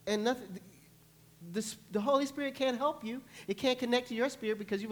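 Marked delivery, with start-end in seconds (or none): none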